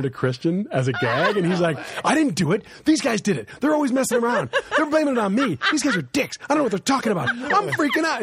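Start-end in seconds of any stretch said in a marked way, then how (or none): 2.0-8.2 high, loud, panicked protest; a frantic roommate losing it. Retold or imitated. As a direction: rattle it off in a frenzy, higher and higher. Roommate Chris panicking, acted quote.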